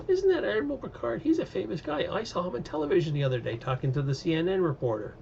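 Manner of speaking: high, excited gossiping onlooker; excited bystander. Pretend. A bystander spots Picard in disguise, high and excited. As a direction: squeal it like a starstruck fan.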